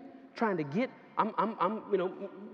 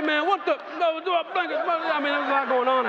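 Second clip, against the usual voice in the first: excited shout; 10 semitones higher, 10 dB louder than usual. Real, not acted. Laughing outburst at the chaos.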